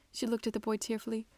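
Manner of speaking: usual speaking voice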